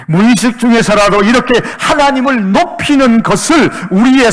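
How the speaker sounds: high, loud, driving sermon delivery; impassioned pulpit shout; full-force preaching. Acted. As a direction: preach it out at full volume, pressing each phrase.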